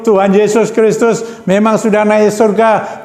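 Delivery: rally the congregation with rising fervour; fervent preaching. Acted. Preacher urging the congregation not to fear, fervent exhortation.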